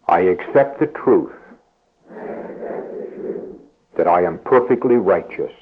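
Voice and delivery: low, slow prayer with long pauses for the congregation to repeat. Acted. Preacher leading the congregation in a repeat-after-me prayer, slow and low. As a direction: lead the room in prayer, phrase by phrase, slowly and gently. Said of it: "I'll say it slowly enough so that you can all say it".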